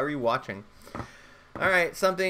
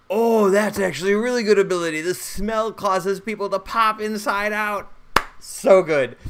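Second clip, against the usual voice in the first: excited; 7 semitones higher, 7 dB louder than usual. Real, not acted. Thrilled at the rolled ability.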